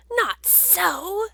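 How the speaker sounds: a touch of a weary worm; mild sigh of a worm. Acted. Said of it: "sighed the worm".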